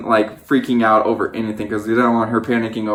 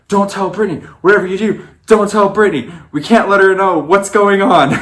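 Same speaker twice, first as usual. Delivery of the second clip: urgent plea; 8 semitones higher than usual. Retold or imitated. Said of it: "running around crazy".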